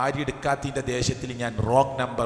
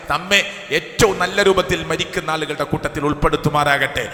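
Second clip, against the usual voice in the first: rising sermon fervour; 3 semitones higher, 6 dB louder than usual. Acted. Preacher warning the congregation that death comes suddenly, fervent and rising. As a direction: urge the congregation, building in intensity.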